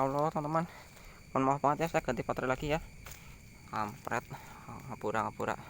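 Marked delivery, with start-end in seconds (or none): 3.7-5.6 low muttered curse; cursing under breath. Real, not acted. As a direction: swear quietly to yourself. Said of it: "Saya ngomong kasar".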